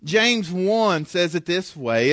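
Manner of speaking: normal talking voice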